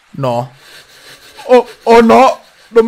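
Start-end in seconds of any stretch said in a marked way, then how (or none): 1.4-2.9 panicked yell. Real, not acted. Caught by monster, panicked.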